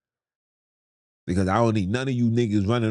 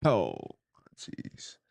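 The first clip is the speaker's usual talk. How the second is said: whispered exasperation; 4 semitones lower, 6 dB quieter than usual. Real, not acted. Exasperated aside.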